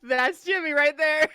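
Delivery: slow, laughing and loud. Real, not acted. Laughing at profile.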